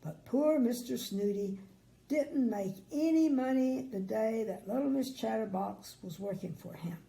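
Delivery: usual speaking voice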